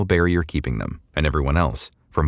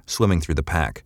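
ordinary delivery

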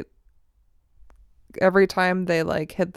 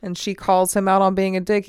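ordinary delivery

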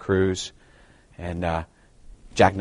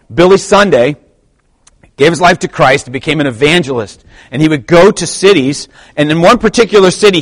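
raised, louder pulpit voice; impassioned preaching; 9 semitones higher, 8 dB louder than usual. Acted. Preacher tells church of an evangelist's demand to the mayor, impassioned. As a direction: preach it out with rising force. Preaches the Billy Sunday story.

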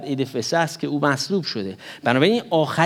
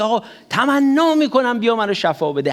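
higher, louder desperate plea of a blind man; begging plea; 6 semitones higher, 3 dB louder than usual. Retold or imitated. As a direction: beg him to come and heal you. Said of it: "با چه التماس و تمنایی میگه".

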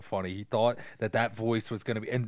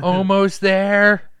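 very slow, high and loud; mock-tense pilot, drawn right out; straining pilot; 10 semitones higher, 11 dB louder, much slower than usual. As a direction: stretch each word as the target nears. Acts pilot closing on target.

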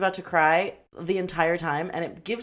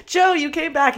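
high, loud mock joy; excited reunion; 10 semitones higher, 9 dB louder than usual. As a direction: cry out in hammed-up delight, high and loud. Acted reunion of movie lovers.

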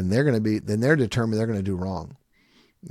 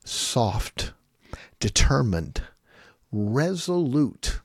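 slow, soft, determined; much slower than usual. Shown as a demonstration. Summing up the parent's voice, slow and determined. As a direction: give each word its own resolute beat. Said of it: "So with your voice, slow, soft".

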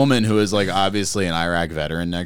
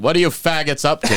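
louder, higher imitation of a brash older guy; mock loud greeting; 5 semitones higher, 5 dB louder than usual. Godfather greeting his godson on a walk, mock loud. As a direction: call it out brashly across the street.

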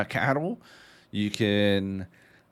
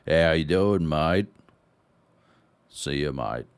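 mock Australian greeting, lazy and low; Aussie pub chorus; 5 semitones lower than usual. Imitating pub NPCs greeting every player, mock Australian accent. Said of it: "you'll just hear this chorus of".

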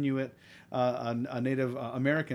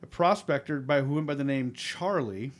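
normal talking voice

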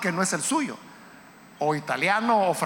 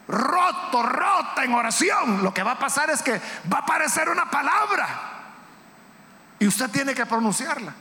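raised, higher-pitched sermon delivery; impassioned preaching; 4 semitones higher than usual. Real, not acted. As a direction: preach it with rising fervor. Preaches at full force.

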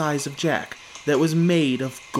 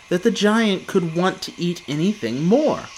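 plain reading voice

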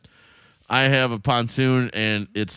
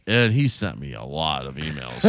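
usual speaking voice

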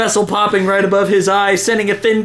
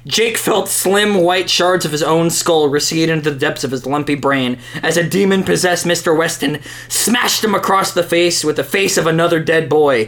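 normal talking voice

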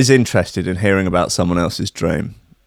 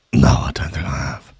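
whispered priest in the confessional; hushed priest; 9 semitones lower, 3 dB quieter than usual. Acted. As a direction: whisper it like a priest behind the screen. Priest answers in confession skit.

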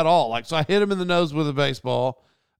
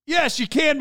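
sharp, much higher, louder comeback; defiant retort; 13 semitones higher, 5 dB louder than usual. Retold or imitated. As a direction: snap back, insisting you're right. Retorting to offended people.

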